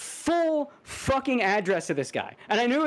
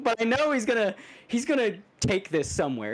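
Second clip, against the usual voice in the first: ordinary talk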